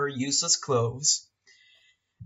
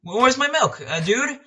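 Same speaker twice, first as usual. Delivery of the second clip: high, loud demanding yell; shouting; 10 semitones higher, 4 dB louder than usual. Retold or imitated. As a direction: bark for the missing milk. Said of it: "started, like, shouting".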